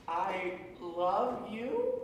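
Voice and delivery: "I love you?" slow and uncertain, rising at the end; said as a question. Retold or imitated. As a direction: ask it instead of declaring it. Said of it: "you basically phrase it as a question".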